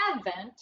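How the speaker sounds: advent as EV-ent, short E stressed up front; short first vowel. Shown as a demonstration. Teacher recaps the second try, short first vowel. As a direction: open with a short "ev", as in "ever". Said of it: "we tried".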